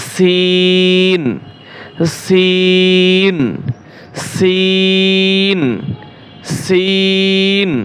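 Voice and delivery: সিন held very long and thin; drawn-out letter. Shown as a demonstration. Teacher drilling students on a long, thin সিন. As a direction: pull সিন out slowly, lightly. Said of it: "পাতলা করে উচ্চারণ করবেন।".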